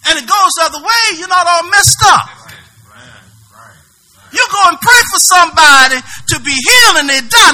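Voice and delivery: loud, rising preacher's cry; preaching shout. Real, not acted. Preacher shouting to the congregation, loud and fervent. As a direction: drive it home at full force.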